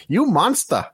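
high-pitched accusing cry; mock high female voice. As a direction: imitate a scolding masseuse in broken English. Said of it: "the woman who gave me the massage barely spoke any English".

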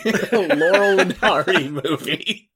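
high, squeaky laughing through the words; helpless laughter. Real, not acted. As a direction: struggle to speak while cracking up, dragging the end. Cracking up imagining Shrek.

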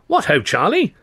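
high, loud hearty greeting; cheery cry. A narrator's reading. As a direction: hail him like an old chum. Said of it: "Luscombe cried".

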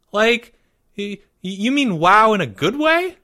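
put-on high, halting child voice; falter, pitched well up; hesitant young boy. A narrator's reading. Timmy unsure how to warn his friend, high and hesitant.

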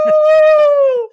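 high, loud cheering woo; hammed-up victory whoop; excited whoop. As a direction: whoop like a fan going wild. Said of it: "Give me your best excited".

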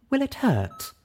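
high, anxious question; worried mare asking the doctor. Acted. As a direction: ask afraid of the answer. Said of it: "sounding worried".

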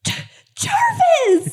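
high outraged call; shocked shout. Acted. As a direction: yell the name in dismay. Player shocked at killing.

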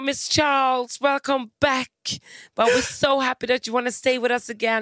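high, slow, sugary staff greeting; high drawn-out welcome; mock hotel welcome. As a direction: gush it like a fawning receptionist.

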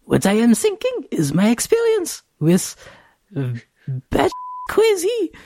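put-on very high, squeaky child voice; little kid voice. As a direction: talk like a tiny preschooler. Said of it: "use your little kid voice".

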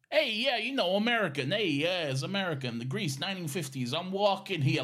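high, loud mock New York accent; badly faked, hammed-up American accent. Shown as a demonstration. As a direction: overdo a brash American accent. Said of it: "had to do an American accent".

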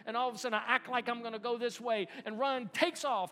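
loud, high, climactic sermon storytelling; full-force pulpit climax; impassioned preaching. Acted. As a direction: build the story to a booming peak.

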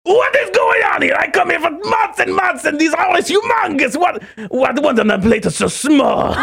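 high outraged Watto yell; Watto losing it. Retold or imitated. Watto yells at the waiter over the tiny portion. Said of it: "he loses his shit".

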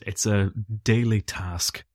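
ordinary talk

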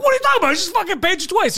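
mock outraged john. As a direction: demand it in disbelief. Retold outraged customer.

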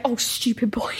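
whispered, scolding himself; a boy whispering at his own mistake; mock whispered self-scolding. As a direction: hiss it under your breath at yourself. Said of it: "Imagine him being like".

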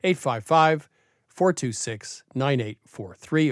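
phone number spelled out slowly, digit by digit; over enunciating each digit; slow and clear. Shown as a demonstration. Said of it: "One more time slowly".

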